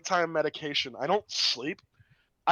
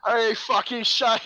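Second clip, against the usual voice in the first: drawn-out, higher Cockney voice; loud mock Cockney, stretched out; Cockney accent; 5 semitones higher, 5 dB louder, slower than usual. Retelling his dumb British dream detectives, in broad Cockney. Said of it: "is that Cockney?".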